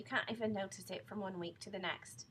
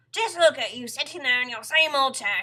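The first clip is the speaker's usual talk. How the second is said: loud, raised exclamation; crying out; 5 semitones higher, 16 dB louder than usual. A narrator's reading. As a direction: call her out at full volume. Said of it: "he cried".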